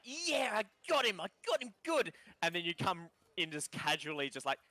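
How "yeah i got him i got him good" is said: high-pitched triumphant gloat; gloating kid. Acted. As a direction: crow in a high little voice. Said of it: "you see his face shift".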